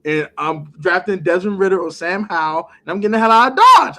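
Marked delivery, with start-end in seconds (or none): 2.8-4.0 louder and faster, exasperated; louder, rushed exasperation; getting out. Real, not acted. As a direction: fling it out like fleeing. Exasperated about the trade price.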